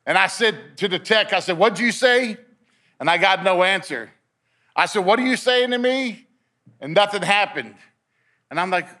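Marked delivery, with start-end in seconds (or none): 1.6-2.4 higher-pitched baffled question; retold puzzled demand. Patient questioning the tech about a voice, puzzled. 5.0-6.3 high, puzzled re-asking; retold insistent question. Patient asking the tech once more, insistent. Repeats question after no answer.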